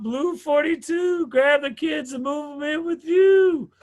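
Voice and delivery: high, loud, slow quarterback shout; calling plays. Retold or imitated. Quarterback shouting a joke play call at the line. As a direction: bark the snap count like a quarterback. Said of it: "I'm under center talking about".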